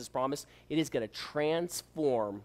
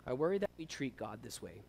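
ordinary talk

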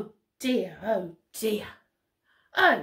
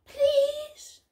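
small, high-pitched beg; pleading blue monkey; 13 semitones higher than usual. Acted. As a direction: squeak out one more plea. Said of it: "says Blue Monkey".

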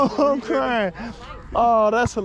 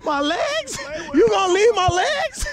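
high-pitched mock outrage, breaking into laughter; laughing mock disbelief; 7 semitones higher than usual. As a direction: tease with a high, incredulous question. Mocking the friend's leg giving out.

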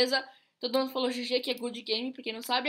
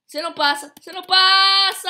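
shouting, louder on the repeat; triumphant shout; 4 semitones higher, 10 dB louder than usual. Real, not acted. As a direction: yell it as you block them. Bloqueando inimigo no jogo. (grito desafiador)

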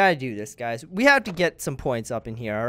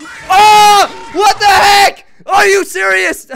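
high loud slow shocked yell; scared scream; 17 dB louder, much slower than usual. Real, not acted. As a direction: shriek at the sudden scare. Jump scare in horror game.

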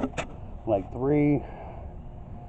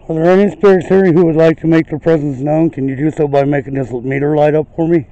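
loud, raised, projected questioning; calling out to spirits; 4 semitones higher, 10 dB louder than usual. Real, not acted. Ghost hunter asking spirits to light the meter, calling out loudly. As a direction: project it to an unseen listener.

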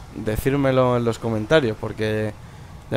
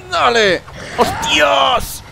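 high excited shout; 11 semitones higher, much slower than usual. Real, not acted. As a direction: yell it out mid-fight. Fight climax, shouts.

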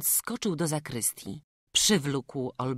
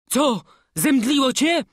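mildly raised, anxious question; worried call; 11 semitones higher, 10 dB louder than usual. A narrator's reading. Master calls up to the boy on high, worried. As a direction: call up with concern. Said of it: "Zawołał stwórz".

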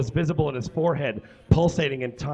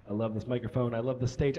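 usual speaking voice

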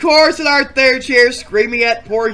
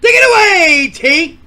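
loud handoff; 8 semitones higher, 9 dB louder than usual. Acted. Host calling the cohost to take over, loud. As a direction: call it out big and high.

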